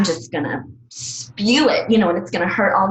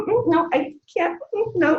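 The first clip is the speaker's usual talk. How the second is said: mock teary refusal; 11 semitones higher than usual. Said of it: "we start to cry and we're just like".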